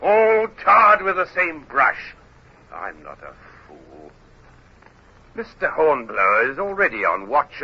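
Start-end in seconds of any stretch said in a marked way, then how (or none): 0.0-2.1 angry condemnation. Acted. Captain condemns all officers. 2.7-4.1 low menace. Acted. Captain drops to menace.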